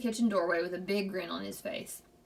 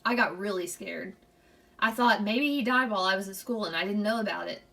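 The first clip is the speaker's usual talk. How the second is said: ordinary delivery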